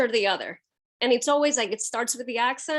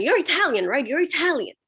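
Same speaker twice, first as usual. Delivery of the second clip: mimicking a neighbor's pushy insistence, pitched up; insistent; 5 semitones higher, 4 dB louder than usual. Neighbor pressing the reporter about being Italian, insistent. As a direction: press the point, higher and louder. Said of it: "like, insistent".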